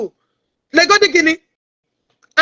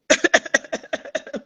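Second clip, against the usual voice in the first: derisive laugh; 6 dB quieter than usual. Real, not acted. Streamer laughing at a rival after insulting them, scornful. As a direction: laugh scornfully under the breath.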